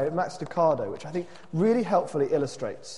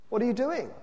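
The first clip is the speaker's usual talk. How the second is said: higher-pitched nervous question; mildly anxious cry; 7 semitones higher, faster than usual. A narrator's reading. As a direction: ask it with a touch of nerves.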